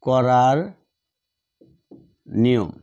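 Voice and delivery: ordinary talk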